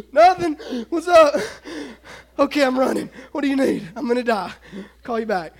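high, loud, gasping phone talk; acted puffing, giving up on the pretence; hiding breathlessness. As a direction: badly fake being fine while winded from running. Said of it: "act like I wasn't running".

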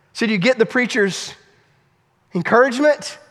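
ordinary talk

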